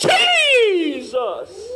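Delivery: "Jesus!" shouted high, rising; distressed cry. Retold or imitated. Preacher mocking praying in distress, shouting. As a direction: yell it like you're desperate. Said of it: "Like you in distress".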